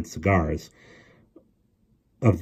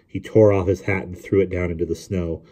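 usual speaking voice

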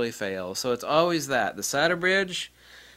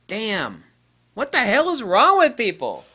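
higher, louder exasperated cry; exasperated outburst; 9 semitones higher, 8 dB louder than usual. Acted. Venting about careless people to viewers. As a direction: vent the frustration.

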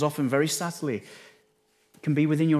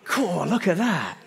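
higher, slow, wide-eyed cool; a lightly played awestruck Jesus gazing at kingdoms; mock awed Jesus; 7 semitones higher, 3 dB louder than usual. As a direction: marvel at the view, drawn out. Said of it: "He didn't have a British accent".

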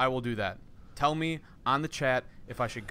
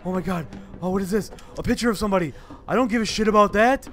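loud, high, urgent exclamations; frantic shouting; 8 semitones higher, 10 dB louder than usual. Real, not acted. Player fleeing with the hatch key, shouting frantically. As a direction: shout it on the run. Fleeing, grabbing items in panic.

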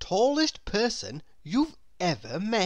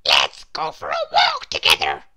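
loud, tiny character voice; Mister Small; 2 semitones lower, 9 dB louder than usual. A narrator's reading. Mister Small questions gloomy Mister Tall, tiny voice. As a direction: pipe it out as a very little fellow. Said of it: "decided to cheer him up".

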